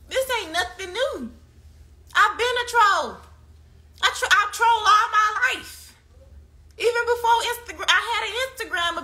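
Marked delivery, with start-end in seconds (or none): 2.1-5.9 higher, louder, slow brag about trolling; proud troll boast. Real, not acted. 6.8-9.0 higher, talking through laughter; laughing. Real, not acted. Laughing about lifelong trolling.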